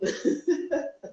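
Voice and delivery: short airy giggle; high breathy laugh. Real, not acted. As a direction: laugh it off lightly. Laughs at own yawning.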